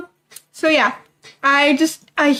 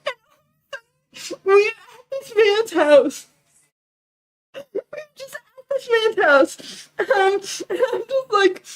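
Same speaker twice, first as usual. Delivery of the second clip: slow, higher-pitched words through laughter; laughing disbelief; 5 semitones higher, much slower than usual. Real, not acted. Storyteller recalling landing at a stranger's house, laughing. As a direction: laugh helplessly as you tell it.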